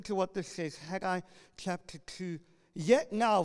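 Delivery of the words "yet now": loud proclamation of the text; ringing preacher voice; preached scripture reading. Acted. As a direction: declare it from the pulpit.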